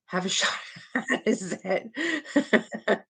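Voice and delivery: slow giggling have a shot at it; amused mangled name; laughing through it. Real, not acted. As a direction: let a laugh break up the phrase. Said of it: "Wait a minute".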